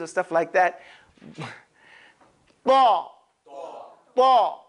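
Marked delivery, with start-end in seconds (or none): 2.6-4.6 "Thaw" with lips pushed round, an exaggerated aw. Shown as a demonstration. Teacher exaggerating for the class, rounded "Thaw". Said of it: "I'm exaggerating slightly just so you can see".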